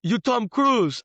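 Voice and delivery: high, loud starstruck question; excited local guy. Retold or imitated. Local guy thinks he sees Tom Cruise, high loud excited question. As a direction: ask it like he's spotted a movie star. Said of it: "He's like".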